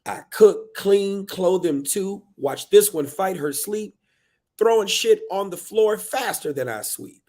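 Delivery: high, loud list of chores; fed-up rant. Acted. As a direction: build an exasperated tirade. Book's parent lists burdens, fed up.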